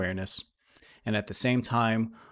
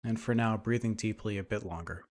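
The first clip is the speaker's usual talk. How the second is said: guided meditation; 5 dB quieter than usual. Acted. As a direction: lead them gently through breath and imagery, unhurried.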